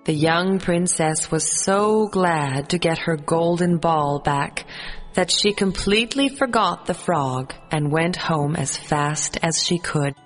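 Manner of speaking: ordinary delivery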